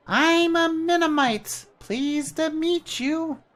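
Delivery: very high, slow, squeaky sprite voice; a tiny bird-sized helper, cheerful; chirpy little creature. Acted. Jan introduces himself to the traveller, high and chirpy.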